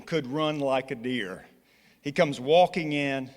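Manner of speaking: normal talking voice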